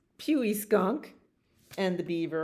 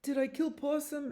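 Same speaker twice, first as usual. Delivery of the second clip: high, softer animal voice; worried beaver; 10 semitones higher, 4 dB quieter, faster than usual. Acted. Beaver fears it killed Possum, high worried voice. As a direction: pipe it up anxiously. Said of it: "No, the beaver said that".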